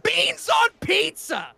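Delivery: high, slow, hammed-up scream of "Beans on pizza!"; bloodcurdling cry. Shot in the foot, he screams it in hammed-up agony. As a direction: wail it out slowly in pain. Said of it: "I yell out with a blood curdling cry".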